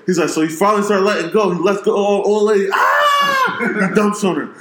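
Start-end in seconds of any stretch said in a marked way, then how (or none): 2.7-3.6 very high, drawn-out mock cry; hammed-up falsetto "ah!"; mock climax cry. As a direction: wail it high and long, imitating. Acting out the bit's climax.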